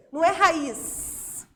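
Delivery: "raiz" ending on a long drawn-out "sss"; hissed final S. Shown as a demonstration. Teacher showing it is not spelt with S, slow and exaggerated. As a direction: stretch the last sound of "raiz" into a long S. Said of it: "a forma de falar".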